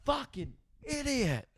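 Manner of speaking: high, soft, slow mock yell; replaying his own outburst in a high hushed voice. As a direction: squeak out what mum overheard. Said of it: "all she's heard is me go".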